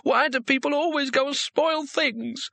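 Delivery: put-on high, sulky tearful girl; young woman voice.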